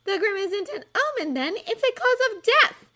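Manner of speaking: put-on brainy girl smugly correcting Ron; superior tone. A narrator's reading. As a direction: talk down to him. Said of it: "in a superior tone".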